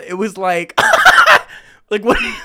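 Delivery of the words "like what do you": high bursts of laughter; helpless laughter; laughing. Real, not acted. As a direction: crack up mid-sentence. Laughing at the memory.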